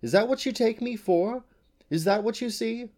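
loud, high-pitched outrage; indignant demand. A narrator's reading. Unicorn challenging the man who took her for a horse, indignant. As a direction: climb high in offended disbelief. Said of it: "she demanded".

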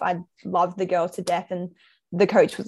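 usual speaking voice

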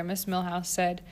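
ordinary delivery

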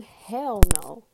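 shouted, defiant no; loud hell no. Real, not acted. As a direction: blast it out in defiance. Emphatic answer to own question.

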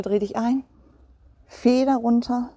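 ordinary talk, nothing marked

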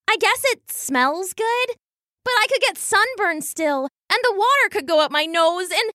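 high, hesitant little voice turning worried; reluctant fretful weasel. A narrator's reading. Weasel sister admitting it to family, still fretful.

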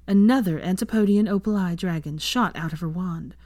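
ordinary delivery, nothing marked

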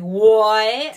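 shocked what. Retold or imitated. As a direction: blurt it out in disbelief. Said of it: "and I was like".

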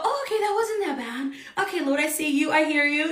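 soft, high mock relief; acted relief. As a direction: act out settling down after a scare. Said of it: "And then we go".

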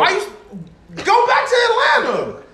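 high laughing yell; laughing shout. Real, not acted. Friend mocking a rapper, laughing high yell. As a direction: holler it through a laugh.

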